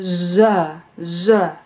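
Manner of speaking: z said as zh, a buzzed sh with a short uh; z, z as two separate voiced zhuh sounds. Shown as a demonstration. Teacher showing the second fricative, z voiced as zh, repeated. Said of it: "the second one is the sound".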